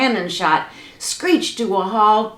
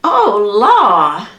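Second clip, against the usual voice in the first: hollering; 7 dB louder than usual. Acted. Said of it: "Aunt Molly hollered at the top of her lungs".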